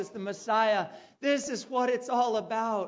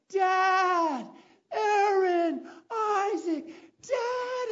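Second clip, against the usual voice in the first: high, loud, tearful cry of names; father and boys crying out as they reunite; emotional reunion; 9 semitones higher, 4 dB louder, much slower than usual. Retold or imitated. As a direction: overcome with joy at finding them.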